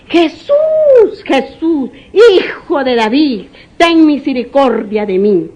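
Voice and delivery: begging shout; blind man crying out. Acted. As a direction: call out to Jesus over the crowd. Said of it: "gritó el ciego".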